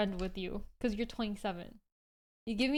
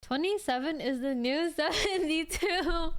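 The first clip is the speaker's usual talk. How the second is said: laughing through the joke, higher; 6 semitones higher than usual. Real, not acted. Streamer jokes with chat about getting old, laughing. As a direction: crack up mid-line.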